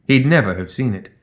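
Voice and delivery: usual speaking voice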